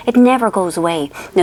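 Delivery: ordinary talk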